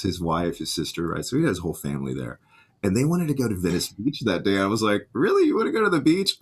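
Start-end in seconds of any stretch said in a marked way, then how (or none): 5.1-6.4 surprised. Retold or imitated. Incredulous at beach plan.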